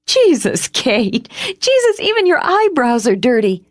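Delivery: high, breathless laughter through the words; laughing. A narrator's reading. Margot laughing helplessly at grimy Kate. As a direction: crack up at your friend's dirt.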